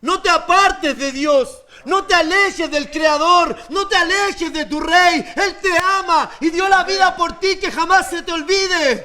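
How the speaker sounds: slow, emphatic preaching, each phrase weighted; pleading sermon appeal. Acted. Preacher begging congregation not to leave God, slow fervent plea. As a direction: beg them to stay close to God.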